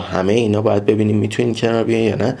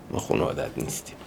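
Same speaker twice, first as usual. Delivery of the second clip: much lower and softer, hurried; hushed retold rejection; 8 semitones lower, 11 dB quieter, faster than usual. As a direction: drop to a quick, near-voiceless murmur. Acted rejection of the dog.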